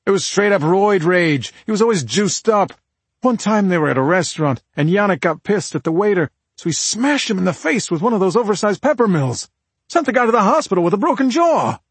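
high, forceful rant, building to the end; heated brother-in-law. Acted. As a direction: tell it hot and emphatic. Voices husband ranting about violent boyfriend.

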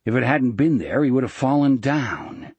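usual speaking voice

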